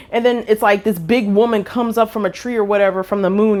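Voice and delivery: ordinary delivery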